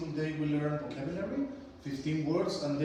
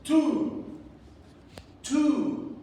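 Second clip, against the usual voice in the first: higher and louder, a clear model for the class; loud model word; 9 semitones higher, 5 dB louder than usual. Shown as a demonstration. Teacher calling a word out for the class to repeat, loud and high. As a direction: call the word out for the class to echo. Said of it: "Repeat after me".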